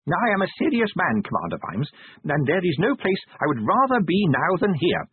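high-pitched, quavering little man being brave; frightened but resolute, voice pitched high; teeth chattering. A narrator's reading. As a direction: declare it earnestly through chattering teeth. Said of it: "his teeth chattering".

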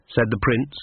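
ordinary delivery

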